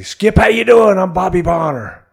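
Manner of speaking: bright loud hello to the new boss; eager rookie greeting. Retold or imitated. Rookie greeting his manager Earl for the first time, eager and loud.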